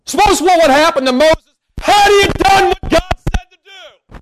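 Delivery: high, loud preaching cry; preaching shout. Real, not acted. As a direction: lift it up and shout it to the church. Preacher rising to full force.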